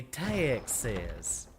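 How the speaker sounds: TEXAS drawn out in a Texan drawl, "Tah-ee-ix-iz"; Texan drawl on Texas, extra syllable in the first vowel. Shown as a demonstration. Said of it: "stereotypical Texan accent would pre-, would pronounce it".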